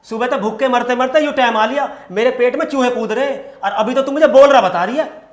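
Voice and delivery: exasperated husband's tirade; angry complaint. Acted. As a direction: complain heatedly, building to a loud finish. Hungry husband keeps scolding his wife.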